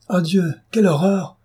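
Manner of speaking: high-pitched cry of disgust, Ah Dieu; horrified exclamation. A narrator's reading. Baronne horrified by the smelly fishing nets, high cry. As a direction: shriek out your horror at the smell. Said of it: "s'écria la baronne".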